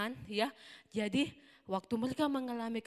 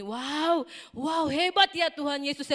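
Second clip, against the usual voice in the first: high, loud amazed cry; amazed wow; 5 semitones higher, 7 dB louder, slower than usual. Acted. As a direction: marvel loudly at Jesus. Teacher voices amazed disciples.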